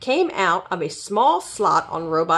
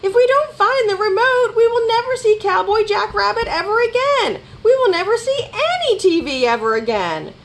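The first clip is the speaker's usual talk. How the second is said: frantic high yell, sustained; hollering; 4 dB louder than usual. A narrator's reading. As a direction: yell in a high-pitched panic. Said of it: "hollered Rabbit".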